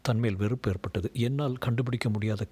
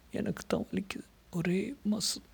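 soft, high-pitched female voice; a small high complaint of pain; hurt woman; 11 semitones higher, 4 dB quieter than usual. A narrator's reading. Hurt woman, narrated as Leena.

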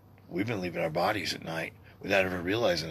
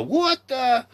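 startled high yelp; a mock shocked squeak; what the; 6 dB louder than usual. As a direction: jump up in pitch, caught off guard. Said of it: "one day you look back and you go".

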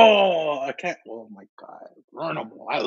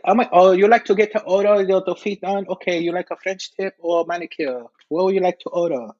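higher, accented salon worker impression; nail technician; 4 semitones higher than usual. Nail tech asks a customer's order, put-on salon voice. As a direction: play an eager nail tech taking an order. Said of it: "Go get your nails done".

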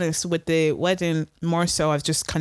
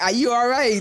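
higher-pitched mock disbelief; retold incredulous reaction; 7 semitones higher, 4 dB louder than usual. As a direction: throw it back, higher, as if the request were absurd. Retold incredulous reply to PS5 request.

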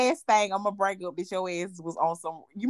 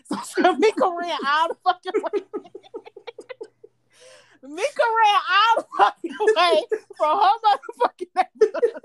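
cracking up while getting the words out; real laughter breaking through the story; laughing; 8 semitones higher, 4 dB louder than usual. Real, not acted. Telling the friend how Mika ran over, laughing hard.

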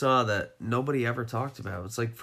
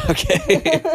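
laughing; 9 dB louder than usual. Real, not acted. Wrapping up laughing.

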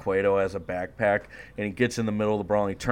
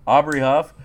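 higher, louder pumped-up question; excited hype; 6 semitones higher, 11 dB louder than usual. Real, not acted. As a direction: hype it up like a promo. S0 repeats in hype voice.

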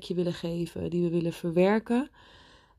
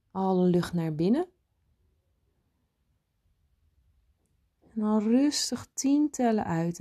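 guided meditation. Acted. Leads a meditation.

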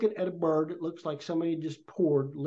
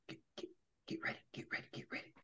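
fast, breathless whisper, much softer; urgent whisper; 20 dB quieter, much faster than usual. Retold or imitated. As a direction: warn him hurriedly under your breath. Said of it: "all of a sudden he goes".

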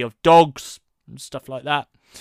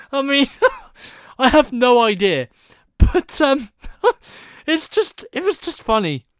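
laughing; 9 semitones higher, 5 dB louder than usual. Real, not acted. Laughing while mocking the film.

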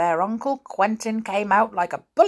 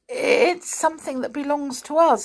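flustered; 9 semitones higher, 3 dB louder than usual. A narrator's reading. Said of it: "going red".

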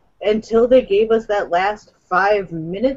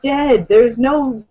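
angry robot voice; 6 semitones higher, slower than usual. Real, not acted. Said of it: "Just full of angry robot voice".

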